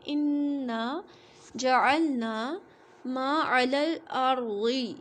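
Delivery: very slow recitation of इन्ना जअलना मा अलल अर्ज़ी with a heavy r; tajweed recitation. Shown as a demonstration. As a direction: draw each word out in Quranic recitation. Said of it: "रॉ साकिन है". (धीमी तिलावत, अर्ज़ी की रॉ मोटी)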